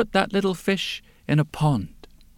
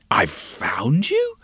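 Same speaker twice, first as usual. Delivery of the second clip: slow, lower, bewildered repeat as a question; puzzled echo; 6 semitones lower, slower than usual. Acted. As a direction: turn his cry into a puzzled question. She puzzles over his words.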